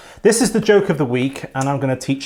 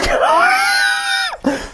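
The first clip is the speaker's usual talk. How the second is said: much higher squealing shark noise; confused shark squeal. Pretend. Host squealing a silly shark impression for friend. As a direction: screech like a baffled shark. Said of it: "my impression of a confused shark".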